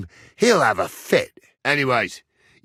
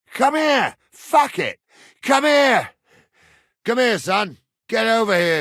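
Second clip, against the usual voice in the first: high, raised yell; shouted summons; 9 semitones higher, 4 dB louder than usual. Acted. Man cursing and summoning a son, shouting. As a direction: yell across to call him over.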